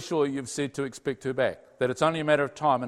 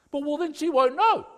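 a pleading whine, raised high; high pleading protest; 11 semitones higher, 4 dB louder than usual. Retold or imitated. A man protesting to God, high and pleading.

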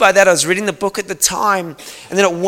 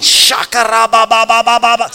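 loud, rapid fiery tongues; speaking in tongues; 5 semitones higher, 5 dB louder than usual. Shown as a demonstration. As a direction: let it rip like a warrior in prayer. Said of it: "that was speaking in tongues".

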